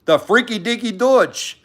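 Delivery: lightly hammed-up movie quote, pitched high; Austin Powers joke. Livestreamer joking with a Dutch viewer, mock movie-quote voice. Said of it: "a quick joke from Austin Powers".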